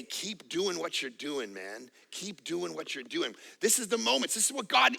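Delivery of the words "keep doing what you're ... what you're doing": soft, slow reassuring whisper-like voice; hushed, tender divine voice; gentle voice of God. Retold or imitated. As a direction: quietly encourage a tired pastor. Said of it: "hear the voice of the shepherd say".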